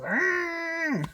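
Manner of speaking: sharp "Argh!" of frustration; short angry groan; frustrated growl. Retold or imitated. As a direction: let out an annoyed growl. Frustrated outburst at work.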